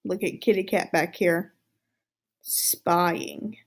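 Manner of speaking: usual speaking voice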